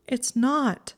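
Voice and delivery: high, upset protest; thick-voiced. A narrator's reading. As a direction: protest through a thick throat. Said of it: "she said in a thick voice".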